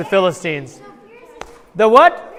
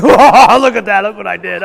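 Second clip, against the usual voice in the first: gloating laugh; 8 dB louder than usual. Retold or imitated. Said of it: "Luke's over here going".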